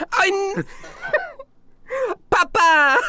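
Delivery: Isabella. Acted. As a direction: call out in a high put-on voice. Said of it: "And Isabella goes".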